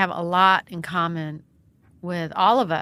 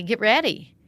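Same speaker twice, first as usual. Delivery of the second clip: ordinary talk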